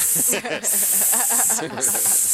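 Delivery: long mock snake hisses; drawn-out sibilant hissing. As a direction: hiss like a snake rehearsing. Imitates snakes rehearsing arias.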